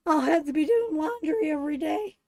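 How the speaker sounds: words through laughter; laughing. Real, not acted. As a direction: laugh it out, amused. Laughing at husband's reaction.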